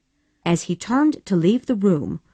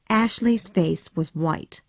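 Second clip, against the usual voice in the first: usual speaking voice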